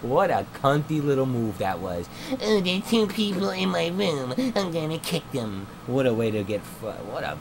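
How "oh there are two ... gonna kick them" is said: high mock of a petty owner; a hint of a whiny sneer. Mocking the server owner.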